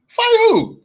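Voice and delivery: high, loud scoffing yell; incredulous shout. Real, not acted. Streamer yelling at senator on screen, high incredulous shout. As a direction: shout it like the idea is absurd.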